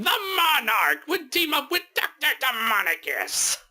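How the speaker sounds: cartoon villain voice. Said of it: "Where you would have, like".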